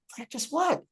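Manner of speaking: high, sceptical question; retold incredulous practice what; baffled retort. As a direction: throw it back in confusion. Baffled at teachers again.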